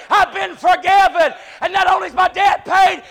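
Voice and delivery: shouted, high, slow preacher climax; sermon peak, voice ringing high and loud; preaching at full force. Acted. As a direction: hoot it out to the rafters.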